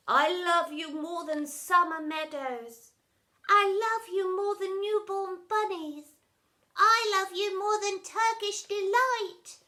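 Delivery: soppy declarations. A narrator's reading. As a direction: coo each line to each other. Family declaring love.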